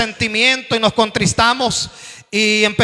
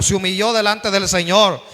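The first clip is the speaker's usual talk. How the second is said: ordinary talk, nothing marked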